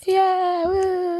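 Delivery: high, drawn-out whoop; imitated crowd cheer.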